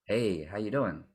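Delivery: slow, low-key "Hey, how you doing?"; low slow greeting. Shown as a demonstration. Coach trying the same greeting low and slow. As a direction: drop it down and take your time. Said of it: "we experiment with our voice and be like".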